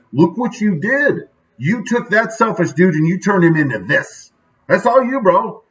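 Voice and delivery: high, emphatic praise, stretched out at first; proud exclamation. Acted. Speaker imagines crediting Precious for changing him, proud and high-pitched. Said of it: "I want him to be proud, like".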